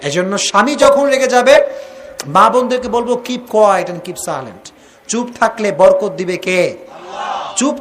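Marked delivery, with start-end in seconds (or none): 3.1-4.7 very slow, drawn-out words; slow advice. Shown as a demonstration. Preacher urging wives to stay silent, very slow. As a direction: lay out each word slowly. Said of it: "advice to wives".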